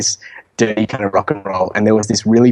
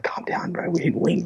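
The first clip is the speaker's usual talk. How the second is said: soft whispered warning; retold whisper; 7 semitones higher, 9 dB quieter than usual. Friend quietly warning the drunk kid on stage, whisper. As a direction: hush him so nobody hears.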